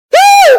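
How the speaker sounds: high, loud startled scream; frightened scream. Acted. Mother scared by a ghost at the door, screaming. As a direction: shriek at the sudden knock.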